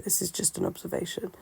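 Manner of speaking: ordinary talk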